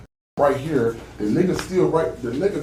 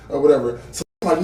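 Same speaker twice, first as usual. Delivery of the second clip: ordinary talk, nothing marked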